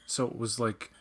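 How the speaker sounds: ordinary talk, nothing marked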